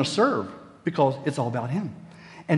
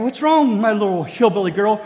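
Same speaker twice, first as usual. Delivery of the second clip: put-on cooing to upset child; 6 semitones higher, 5 dB louder than usual. Father cooing to upset daughter.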